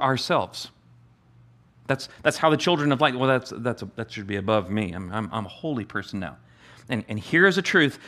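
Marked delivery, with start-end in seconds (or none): 1.9-6.4 fading into soft, lower self-righteous tones; hammed-up holier-than-thou murmur; mock pious believer.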